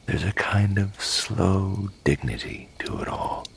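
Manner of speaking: ordinary delivery, nothing marked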